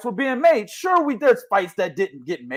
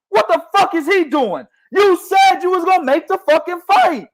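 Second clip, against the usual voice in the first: high-pitched, indignant accusation; exasperated rant; 8 semitones higher than usual. Real, not acted. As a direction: call him out on a broken promise. Rants at boxer breaking promise.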